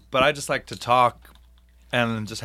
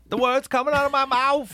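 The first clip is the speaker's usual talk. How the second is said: much higher squeaky Chris Tucker voice; Chris Tucker impression; 13 semitones higher than usual. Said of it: "the highest voice ever".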